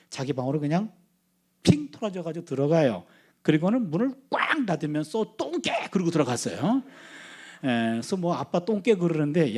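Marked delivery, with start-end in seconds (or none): 5.4-5.7 high, childish mock shout of an insult; child's parting insult. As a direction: fling it like a sulky kid slamming the door. Daughter slams door, insults dad.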